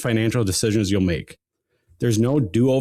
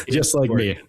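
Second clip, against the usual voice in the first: laughing. Real, not acted. Laughing repeat of the joke.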